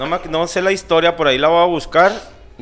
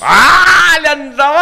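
surprised shout; 14 semitones higher, 9 dB louder, slower than usual. Real, not acted.

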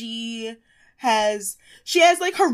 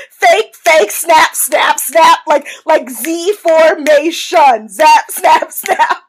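loud, higher rhythmic mock chant; Tyra snapping; 4 semitones higher, 10 dB louder, much slower than usual. Host re-enacting Tyra leaning on Jay, loud hammed-up snapping chant. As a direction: bark each word on the beat of a snap. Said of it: "she's, like, leaning on Jay".

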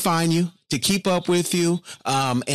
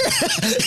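high laugh; 12 semitones higher than usual. Real, not acted. Laughing after impression.

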